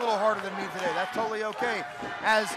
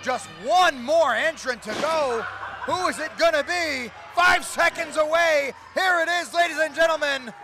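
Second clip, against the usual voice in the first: raised, building hype call; building excitement; 4 semitones higher, 4 dB louder than usual. Real, not acted. Commentator counting down to the final entrant, mounting excitement. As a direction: pump up the crowd for the reveal.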